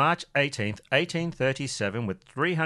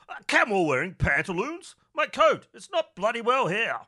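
high, outraged squire; fuming old toff; blustering gentleman; 8 semitones higher than usual. Acted. Rickett finds his coat gone, blustering high.

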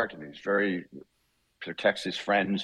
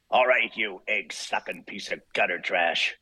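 Wolverine audition line; 3 semitones higher, slower than usual. Shown as a demonstration. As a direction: snarl the insult, then a sudden pained yelp. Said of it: "I said the line, and this line was".

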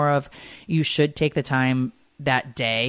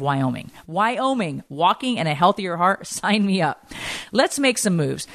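upbeat, peppy sponsor read; energetic host-read ad; 4 semitones higher than usual. Acted. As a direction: sell the walking challenge brightly. Sponsor ad read mid-show.

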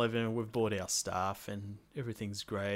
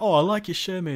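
higher and louder, keen and friendly; eager compliment; 8 semitones higher, 9 dB louder than usual. Retold or imitated. Complimenting a stranger's shirt, eager and loud. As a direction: win him over with the compliment.

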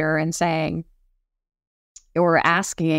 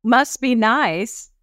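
drawn-out, higher, envious jab; resentful sarcasm; 4 semitones higher, much slower than usual. Acted. Envying people who set limits, resentfully sarcastic. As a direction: stretch it out with mock envy. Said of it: "that feeling of resentment".

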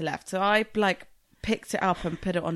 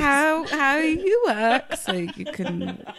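very slow, louder grand slogan; mock catchphrase; 5 semitones higher, 7 dB louder, much slower than usual. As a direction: announce it like a motto. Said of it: "Brilliant catchphrase".

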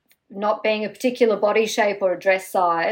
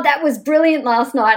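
excited praise; 5 semitones higher, 5 dB louder than usual. Retold or imitated. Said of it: "but he said".

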